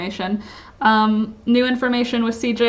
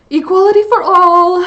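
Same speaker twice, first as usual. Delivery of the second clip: emphatic; 9 semitones higher, 9 dB louder than usual. Real, not acted. Outrage at bigoted businesses.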